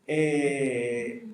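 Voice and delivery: mock a child searching for an answer; stalling. Child asked what was learned at church, acted stalling.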